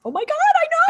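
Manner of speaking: very high squeal; real excited squeal of agreement. Real, not acted. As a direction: squeal with delight. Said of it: "excited agreement".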